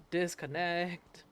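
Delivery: slow, stretched word; drawn-out. Retold or imitated. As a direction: drag the word out. Drawn-out mock rage quit.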